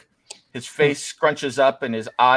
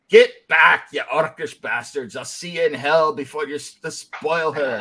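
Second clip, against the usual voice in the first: furious knight; slower than usual. Acted. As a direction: drive them back with fury. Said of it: "He goes".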